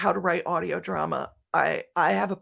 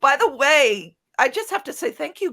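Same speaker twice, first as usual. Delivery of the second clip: excited surprise; 7 semitones higher, 8 dB louder than usual. Real, not acted. Surprised to see the team.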